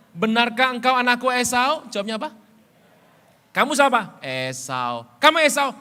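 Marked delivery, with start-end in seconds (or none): 0.1-1.9 put-on high, loud questioning old father; Isaac asking. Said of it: "Dua tiga". 3.5-5.7 loud, high suspicious father; acted Isaac questioning. Acted Isaac-Jacob exchange.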